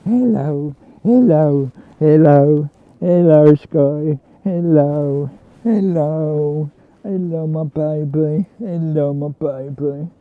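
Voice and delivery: loud, low repeated hellos to the dog; loud joyful hellos to a dog; excited greeting. Real, not acted. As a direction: greet her loud and happy.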